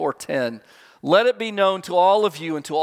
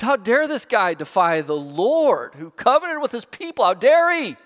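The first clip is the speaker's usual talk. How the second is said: indignant cry; 7 semitones higher than usual. Retold or imitated. David defying Goliath, quoted.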